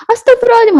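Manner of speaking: high-pitched exasperated cry. Acted. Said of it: "nada yang lebih tinggi lagi". (seruan kesal bernada tinggi)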